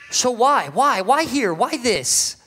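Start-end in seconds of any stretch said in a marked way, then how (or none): none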